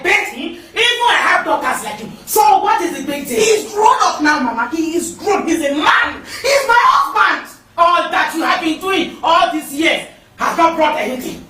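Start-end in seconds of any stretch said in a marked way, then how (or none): 6.2-7.5 higher, slow, shouted indignation; indignant shout. Acted. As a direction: drag each word out in outrage. Wife defends husband to mother-in-law.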